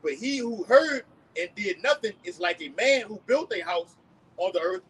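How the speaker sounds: slow, loud preaching read; scripture reading. Acted. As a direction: proclaim the verse slowly. Reads scripture aloud.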